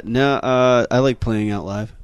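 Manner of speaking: usual speaking voice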